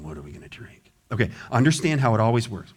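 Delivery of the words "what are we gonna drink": low, soft grumbling mutter; mock low mutter; muttered grumble. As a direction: grumble under your breath. Mocking grumbling people.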